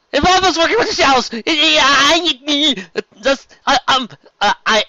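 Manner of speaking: high, loud, tripping over words; can't get the sentence out; flustered stammer. Real, not acted. Reader still reeling from the twist, flustered and loud.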